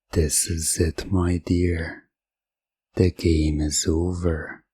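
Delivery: ordinary delivery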